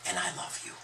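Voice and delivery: a soft unvoiced confession; whispered love. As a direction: breathe it out tenderly. Ad Man's tender confession.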